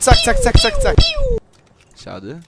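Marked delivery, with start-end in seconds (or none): 0.0-1.0 excited high cry. Real, not acted. Finishing enemy.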